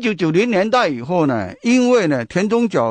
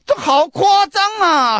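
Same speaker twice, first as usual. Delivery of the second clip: slow, much higher, louder Sichuanese outcry; loud Sichuan dialect; 12 semitones higher, 3 dB louder than usual. Retold or imitated. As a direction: imitate a shocked Sichuan tourist loudly. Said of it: "很大声讲啊". (用四川话大声夸张地喊)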